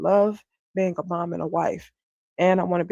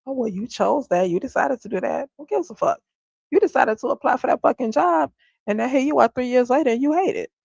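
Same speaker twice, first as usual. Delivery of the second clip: higher, sneering imitation of critics; snide mock lecture, louder on the swear; mock judgy retort; 6 semitones higher, 4 dB louder than usual. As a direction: mimic the smug people who judge you. Mocking judgy people's retort.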